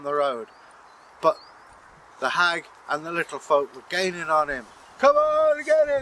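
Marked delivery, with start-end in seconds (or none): 5.0-6.0 high, loud hag cry; hag urging on. Acted. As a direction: screech like a hag egging on the chase. Hag urging little folk chase.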